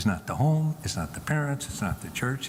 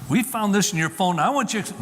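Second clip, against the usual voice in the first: higher, forceful parent's accusation; mock confrontation; 7 semitones higher, 4 dB louder than usual. Parents confronting their son at dinner, accusingly. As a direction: act out the wrong, heavy-handed way.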